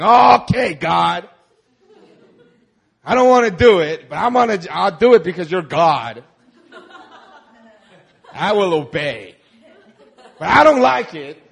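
hammed-up reluctant yes to God; grudging obedience. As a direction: grumble like a sulky child obeying. Grudging believer voiced.

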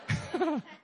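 high quick chuckle; short laugh. Real, not acted. Pastor laughs at claiming credit, high chuckle. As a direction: laugh at your own boast.

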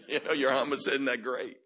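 loud, mildly exasperated put-down; mock scolding. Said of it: "I would hear Jesus go".

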